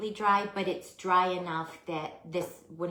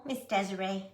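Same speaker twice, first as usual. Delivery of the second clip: ordinary talk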